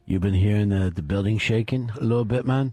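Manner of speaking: lightly voiced low-pitched coworker; low retold coworker's question; imitating a coworker. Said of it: "he goes, uh".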